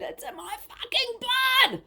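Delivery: high, slow, strained shout; half-scream it out, high and slow; a retold possessed shriek. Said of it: "I'm screaming, screaming and shouting".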